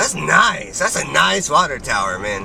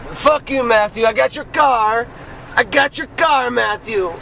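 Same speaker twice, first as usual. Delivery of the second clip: gloating taunt; 6 semitones higher than usual. Real, not acted. Gloating taunt at absent Matthew.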